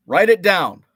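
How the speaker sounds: loud self-reminder. Real, not acted. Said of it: "reminding himself loudly".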